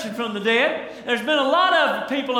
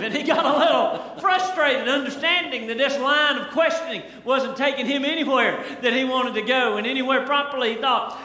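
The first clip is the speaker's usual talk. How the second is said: ordinary talk, nothing marked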